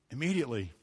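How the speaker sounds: Immediately repeated softly. Shown as a demonstration. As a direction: drop down and echo Immediately. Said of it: "there it is again".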